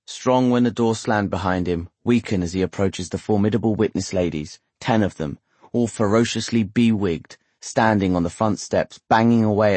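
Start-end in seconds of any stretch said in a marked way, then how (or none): none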